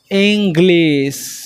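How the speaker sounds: drawn out slowly for the class; slow teacher demo; slow model word. Shown as a demonstration. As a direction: say it slowly and clearly. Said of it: "object কি হবে?".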